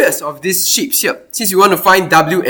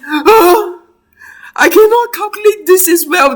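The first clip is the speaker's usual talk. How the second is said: mock dismay; 11 semitones higher, 3 dB louder than usual. Teacher acts dismayed at problem.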